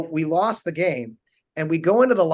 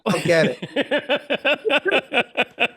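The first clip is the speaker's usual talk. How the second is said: very slow, higher, baffled drawl; deadpan Mark Wahlberg; 9 semitones higher, much slower than usual. Retold or imitated. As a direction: stretch each word, puzzled. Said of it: "Mark just looks at me and he goes".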